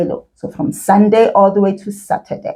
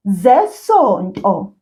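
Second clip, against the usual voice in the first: "Zesonto" drawn out slowly as one word; slow model for repeating; 3 semitones lower, slower than usual. Shown as a demonstration. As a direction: stretch "Zesonto" slowly for a learner to copy. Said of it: "repeat that after me".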